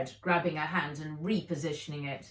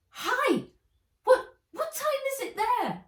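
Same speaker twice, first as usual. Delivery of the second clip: put-on much higher, bright child's greeting; young girl voice; 5 dB louder than usual. A narrator's reading. Wen greeting Nat over the video call, in a much higher child's voice. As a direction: voice a little girl calling hello.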